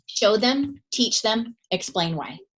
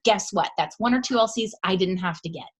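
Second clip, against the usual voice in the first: normal talking voice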